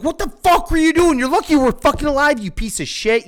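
high, loud retold tirade; going off. Passenger berates the reckless driver, angry high-pitched outburst. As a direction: tear into him, starting high and loud, easing slightly. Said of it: "going off on him".

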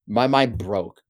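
ordinary talk, nothing marked

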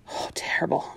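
barely voicing it in dismay; whispered. Florida whispering as she remembers Joey dying. Recalling Joey's death.

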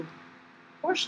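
whisper. Acted. Innkeeper's hushed reply.

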